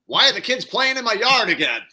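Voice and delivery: put-on high, loud cranky old man; grumpy old man. Old man rant bit.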